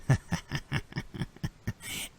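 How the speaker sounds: quiet, breath-only chuckle; stuttering voiceless cackle; villain laugh. Acted. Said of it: "they're like".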